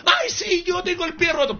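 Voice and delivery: high, mocking falsetto of a gullible woman. Preacher mocking a woman answering the witch doctor, high falsetto. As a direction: squeak it out like a naive client. Viewer claims the broken foot.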